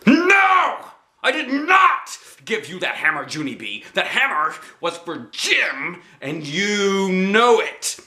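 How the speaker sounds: furious mother. A narrator's reading. Mother furiously denying she gave the hammer.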